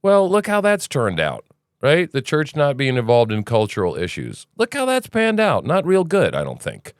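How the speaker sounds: usual speaking voice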